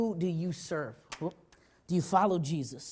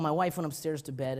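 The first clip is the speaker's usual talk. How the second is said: ordinary talk, nothing marked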